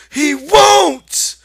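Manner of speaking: high, very loud desperate cry; frantic cry. Acted. Lenny panics at the rabbit, loud frantic cry. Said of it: "Lenny cried frantically".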